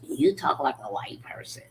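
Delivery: hushed, breathy retelling of the put-down; mock whisper. Repeating what family told her, in a hushed whisper. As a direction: whisper it like a snide aside.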